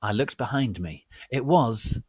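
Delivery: ordinary talk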